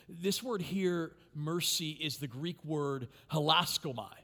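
usual speaking voice

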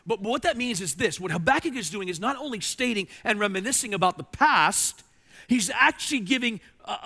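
high, loud, driving sermon voice; fervent preaching. Acted. Pastor urging the congregation toward future faith, fervent preaching. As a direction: preach it with rising fire.

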